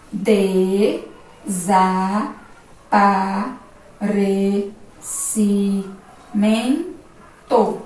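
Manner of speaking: "Desaparecimento" broken into seven slow syllables, clear pauses between; very slow syllable split of "Desaparecimento", each piece held separately. Shown as a demonstration. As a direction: say "Desaparecimento" as de... za... pa... re... ci... men... to. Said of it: "Agora vamos fazer a separação silábica".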